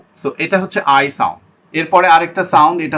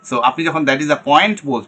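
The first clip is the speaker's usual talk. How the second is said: ordinary talk, nothing marked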